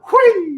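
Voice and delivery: high, loud gleeful squeal; gleeful whee. Retold or imitated. Miming a toss, a high gleeful squeal. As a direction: squeal like tossing something away.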